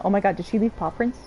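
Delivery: ordinary talk, nothing marked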